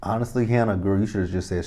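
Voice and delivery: ordinary talk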